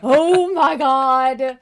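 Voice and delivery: slow, loud, high mock horror; mock outrage. Cohost reacts to the confession with mock outrage. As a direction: drag it out, scandalized.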